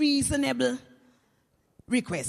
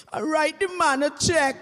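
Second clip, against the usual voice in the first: loud, higher comic punchline; exasperated retort; 4 semitones higher, 7 dB louder than usual. Acted. Widow to her friend who keeps harassing her, loud exasperated retort. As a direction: burst out loudly, as the harassed widow.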